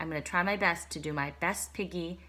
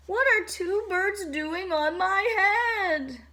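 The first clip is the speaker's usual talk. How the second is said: higher, whimpering complaint; whiny distress; 11 semitones higher, 7 dB louder, slower than usual. Acted. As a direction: fret like it's unfair. Elephant distressed about birds.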